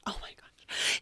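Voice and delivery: whispered, acted-out shock; hushed dismay. Stepmother hears her stepchild's hateful words, whispering in dismay. Stepmother takes insult to heart.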